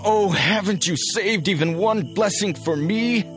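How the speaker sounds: high, bitter outburst. A narrator's reading. Cheated son complaining to his father, high and bitter. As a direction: pour out the grievance, climbing to a plea. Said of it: "Esau said bitterly".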